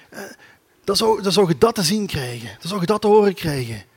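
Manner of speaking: ordinary talk, nothing marked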